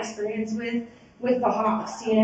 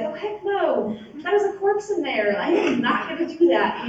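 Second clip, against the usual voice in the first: high-pitched, incredulous inner protest; horrified inner refusal; 5 semitones higher than usual. Retold or imitated. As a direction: voice the panicked private thought. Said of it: "what's going on in my head, of course, is like".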